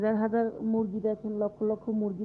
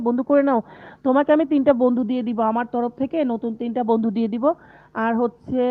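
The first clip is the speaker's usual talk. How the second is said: louder, eager call to a viewer; loud urging; 8 dB louder than usual. Real, not acted. To Jannat, urging her to add friends, loudly. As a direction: call it out so she can't miss it.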